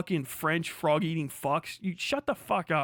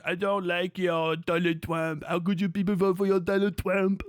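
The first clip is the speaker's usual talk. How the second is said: a light put-on foreign voice, whiny; mock foreigner; 2 semitones lower than usual. Streamer mimicking a European complaining about Trump, mock accent.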